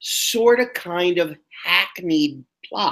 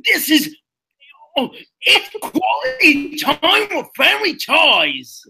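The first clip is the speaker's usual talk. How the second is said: much higher Cockney voice, slowing to a wailing show title; Cockney accent; 10 semitones higher than usual. Acted. Telling the hosts about his Cockney accent, in a higher, wailing Cockney voice. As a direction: hold the Cockney accent right through the show's name. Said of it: "My Cockney accent".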